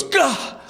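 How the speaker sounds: sharp, high-pitched cry of pain; stabbed gasp. Acted. Steve suddenly stabbed at the party, pained cry. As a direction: cry out as if knifed in the back.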